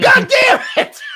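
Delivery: imitated furious high-pitched shout; mad outburst. Game master loses it after a failed intimidate roll, mad shouting. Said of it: "he was so mad".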